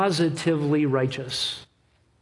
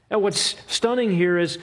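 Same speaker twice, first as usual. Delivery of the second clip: normal talking voice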